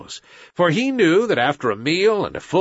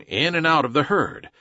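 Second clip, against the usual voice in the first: ordinary delivery, nothing marked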